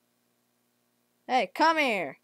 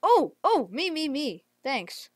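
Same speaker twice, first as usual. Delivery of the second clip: ordinary talk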